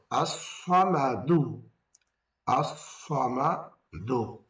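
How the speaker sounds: আস-সামাদু drawn out, each sound slow and clear; slow recitation. Shown as a demonstration. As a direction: spell out আস-সামাদু very slowly. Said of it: "উচ্চারণ শিখি". (ধীরে স্পষ্ট উচ্চারণ)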